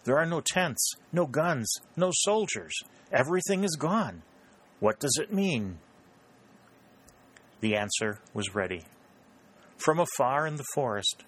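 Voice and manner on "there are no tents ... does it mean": high-pitched astonished listing; narrated bewildered discovery; surprised exclamation. A narrator's reading. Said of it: "she uttered a cry of surprise".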